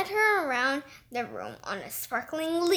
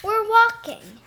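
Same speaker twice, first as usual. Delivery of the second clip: forced cheer; 6 semitones higher, 7 dB louder than usual. Acted. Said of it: "as cheerily as she could".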